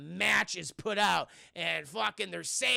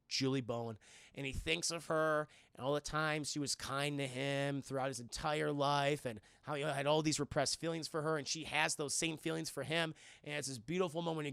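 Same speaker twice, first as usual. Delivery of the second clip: lower, softer dreamy retelling; tender narration; 6 semitones lower, 6 dB quieter than usual. A narrator's reading. Recalling his love for Julie Bowen, softer and lower. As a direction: soften into a sweet memory.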